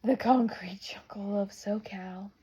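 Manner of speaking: slow, hushed whisper for effect; dramatic whisper. Shown as a demonstration. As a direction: breathe the phrase like a secret. Dramatic whispered name for SoCal.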